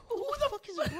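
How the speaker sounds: high, hushed bewildered reaction; baffled. Retold or imitated. Co-host retelling his confusion over a nickname, baffled. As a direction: squeak out your puzzlement, keeping it soft.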